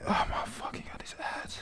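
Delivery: hissed whisper of frustration at the ads; exasperated whispered curse; annoyed whisper. Real, not acted. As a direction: whisper it, fed up.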